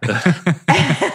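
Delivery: a short burst of real laughter. Real, not acted. Laughing while retelling the gym exchange. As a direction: laugh at the story. Laughs at her own story.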